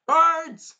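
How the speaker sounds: high, loud command shout; calling guards. Acted. The king shouting for his guards, loud and high. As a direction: summon the guards.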